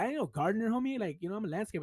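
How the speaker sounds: high-pitched offended objection; mock-indignant protest. Landscaper retelling his objection to the title, indignant. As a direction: push back on the label, voice climbing. Retold protest at being called gardener.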